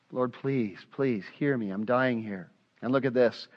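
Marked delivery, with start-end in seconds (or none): 0.1-2.5 softer and lower, begging; pleading prayer. Retold or imitated. Preacher acting his own prayer, quietly begging.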